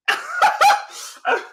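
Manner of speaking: high, loud burst of laughter; real cackling laugh. Real, not acted. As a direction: break up after the impression. Cracks up after the impression.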